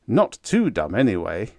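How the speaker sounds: ordinary delivery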